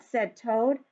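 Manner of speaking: put-on lively read-aloud for young children; story narration. A narrator's reading. Teacher reads picture book aloud.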